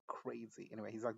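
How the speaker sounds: ordinary talk, nothing marked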